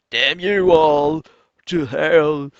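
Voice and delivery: slow, loud, drawn-out damning; melodramatic curse. Acted. Trader cursing the market, slow melodramatic shout. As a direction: cry it out in mock anguish, stretching each word.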